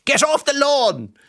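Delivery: put-on high, slow, loud old-man yell; cranky old man shout. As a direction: bellow like a grumpy old president. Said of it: "stuck in President Higgins".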